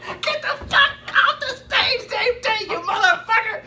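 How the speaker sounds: high, loud yell at the guy on stage, laughing; retold heckling yell. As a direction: scream him off the stage. Yelling at comic on stage.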